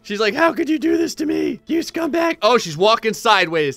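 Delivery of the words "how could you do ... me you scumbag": high, wailing villager voice; hammed-up tearful accusation; mock crying. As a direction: play a wronged lady, pitched way up. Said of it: "This lady's crying. She's like".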